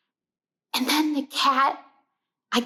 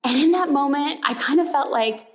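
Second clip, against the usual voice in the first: usual speaking voice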